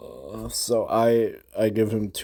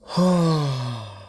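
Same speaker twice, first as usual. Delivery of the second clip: exhausted sigh; 5 semitones higher than usual. Real, not acted. Recalling three hours of losing, big weary sigh. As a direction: let out a big drawn-out sigh. Worn out recalling the long session.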